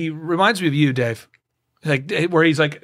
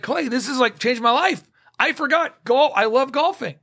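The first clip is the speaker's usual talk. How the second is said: excited; 7 semitones higher than usual. Retold or imitated. Client voiced as overjoyed.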